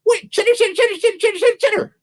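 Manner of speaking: very high, rapid chittering; squeaky high squirrel talk; squirrel chatter. Acted. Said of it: "Which the squirrel talk means".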